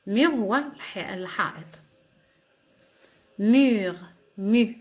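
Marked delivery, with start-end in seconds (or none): 3.4-4.8 Mur then mu, cut down to its vowel; word broken into sounds. Shown as a demonstration. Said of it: "breaking the word into sounds".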